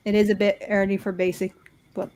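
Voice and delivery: ordinary delivery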